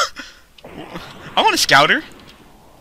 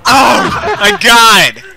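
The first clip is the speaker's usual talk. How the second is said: raging gamer; 10 semitones higher, 7 dB louder, slower than usual. Real, not acted. Game character wrecks his house.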